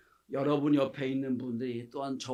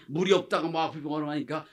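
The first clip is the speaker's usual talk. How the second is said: normal talking voice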